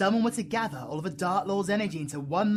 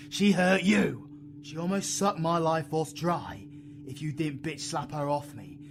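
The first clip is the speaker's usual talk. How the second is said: usual speaking voice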